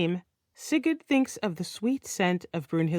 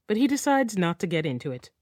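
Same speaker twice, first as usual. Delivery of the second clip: plain reading voice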